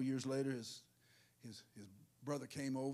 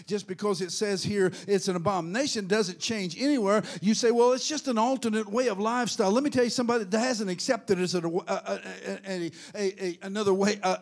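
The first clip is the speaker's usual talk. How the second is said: very loud, high, rhythmic pulpit shouting; driving shouted sermon with gasped "uh" beats; full-force preaching; 8 semitones higher, 20 dB louder than usual. Real, not acted. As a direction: build to a thundering call to repent.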